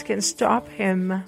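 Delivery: usual speaking voice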